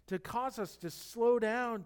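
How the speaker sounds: ordinary delivery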